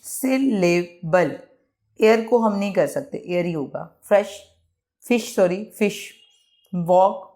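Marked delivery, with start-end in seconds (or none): 0.0-1.4 syllable in three separate beats, sil-le-bul; slow, teacherly break-up of syllable; broken into syllables. Shown as a demonstration. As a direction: pause after each syllable of syllable. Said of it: "इसको break नहीं करना पड़ता".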